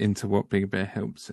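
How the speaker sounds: usual speaking voice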